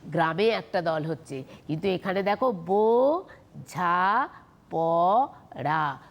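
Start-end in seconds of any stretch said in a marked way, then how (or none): 2.5-6.0 বোঝাপড়া very slowly in four held pieces; open syllables separated. Shown as a demonstration. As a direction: draw out every vowel of বোঝাপড়া. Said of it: "সব কটাই মুক্ত দল".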